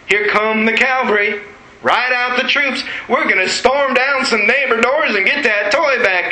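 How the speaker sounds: high-pitched imagined cavalry charge; rally the troops in a high, eager voice; rallying cry. Retold or imitated. Three-year-old summoning the cavalry in his mind, rallying cry.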